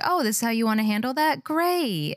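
fast, rising mock-cheery parent; mock permissive. Parent cheerfully letting kid run the show, high mock cheer. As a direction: play the parent giving in. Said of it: "you'd be like".

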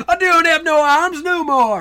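high, loud, drawn-out comic cry; mock despairing wail. Continues armless Scotsman bit.

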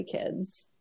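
breathy spooky whisper. Acted. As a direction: whisper it to the kids like a ghost story. Spooky sign-off to listeners.

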